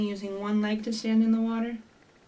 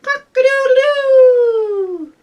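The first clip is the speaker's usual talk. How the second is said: loud, high, long-held rooster crow; 12 dB louder, slower than usual. Acted. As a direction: shout it like a rooster waking everyone. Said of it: "Wake up and shout".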